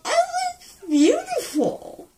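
high tearful wail; crying. Real, not acted. Weepy post-op over nails.

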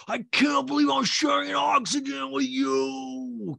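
very high, slow wail; hammed-up drawn-out cry of indignation; repeated mock outburst. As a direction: imitate an outraged yell, stretching the last word. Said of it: "[name] repeats it a second time".

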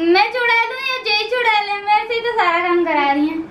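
high, quick cute witch. Pretend. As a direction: rattle it off as a little witch. Girl playing good witch.

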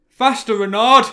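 very high, loud cheer; shouting. A narrator's reading. Lanfert spurring the fox on, high loud shout. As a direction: urge the runner on at full voice. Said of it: "were shouting and gesticulating".

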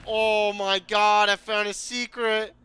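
loud, slowed thrilled outburst; thrilled exclaiming. Real, not acted. Gamer discovering a secret in the game, thrilled shout. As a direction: gasp out the find with joy.

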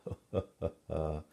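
acted rolling chuckle; king's chuckle. A narrator's reading. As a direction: chuckle as the amused king. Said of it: "he began to laugh".

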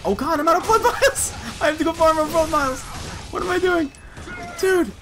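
high, loud, laughing panic; panicked outcry. Real, not acted. Player out of blood vials mid-fight, panicked. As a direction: cry out high in exasperated alarm.

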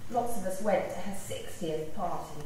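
usual speaking voice

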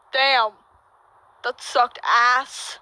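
normal talking voice

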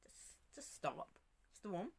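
soft, near-whispered "just stop, storm"; calm and hushed. Retold or imitated. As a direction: breathe the command out gently. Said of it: "You know, calmly".

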